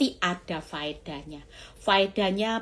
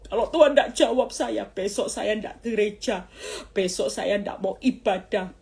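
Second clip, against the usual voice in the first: loud, higher-pitched protest easing off; mock yelling at God; 3 semitones higher, 6 dB louder than usual. Preacher imitates a believer threatening to skip church, mock yelling. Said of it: "nggak usah teriak-teriak lagi".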